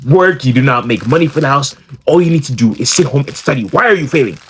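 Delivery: imitating a mom's high scolding, rising in pitch; mock an angry African mom reading his grades. Mom scolding her son over failing grades, a lightly voiced imitation. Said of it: "she's looking at it like".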